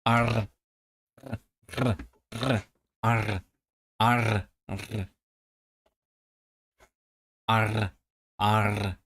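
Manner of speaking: arr ending in a tongue roll; trilled R practice. Shown as a demonstration. Learner unhappy with the feel, trilled R. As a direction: keep trying to roll the R. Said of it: "that doesn't feel good".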